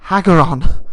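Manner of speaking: loud shout of a name; calling out. Acted. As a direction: call out to someone across the way.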